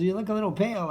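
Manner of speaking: a comic Marty poking at nerves; Marty Short impression. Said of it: "He goes".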